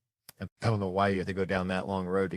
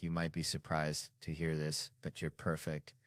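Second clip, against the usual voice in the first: soft, lower voice of a doctor; imagined doctor; 4 semitones lower, 12 dB quieter than usual. Retold or imitated. Doctor gives patient checkup results, soft imagined voice. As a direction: quietly play a doctor giving imagined results. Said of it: "I knew the doctor wasn't gonna be like".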